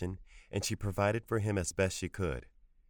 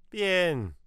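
high, louder angry grandmother; 12 semitones higher, 6 dB louder than usual. A narrator's reading. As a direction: snap it out in anger. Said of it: "she said angrily".

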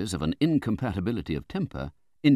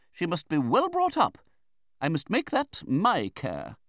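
high, emphatic, domineering aunt; Betsy Trotwood; 14 semitones higher, 4 dB louder than usual. A narrator's reading. Aunt plans the unborn girl's life, insistent. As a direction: lay down the law in a high voice.

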